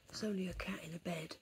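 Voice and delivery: a hint of wildlife narrator; David Attenborough whisper. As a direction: still in a quick nature-show whisper. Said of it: "talking like David Attenborough".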